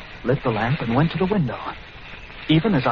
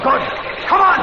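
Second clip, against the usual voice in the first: high, loud urgent shout; 14 semitones higher, 3 dB louder than usual. Acted. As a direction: hurry him up. Fleeing the rats.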